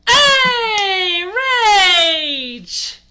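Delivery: high, very loud held "hey"; mock battle cry, long and high; drawn-out yell. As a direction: belt it out, holding the vowels.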